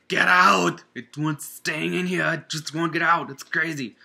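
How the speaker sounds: loud, high hammed-up Austrian action-hero accent; bad Arnold Schwarzenegger accent. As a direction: shout it like a terrible Arnold impression, Austrian accent. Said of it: "terrible accent".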